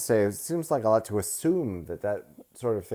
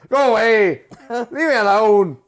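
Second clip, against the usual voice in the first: mock yell; 13 semitones higher, 10 dB louder than usual. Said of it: "He's like".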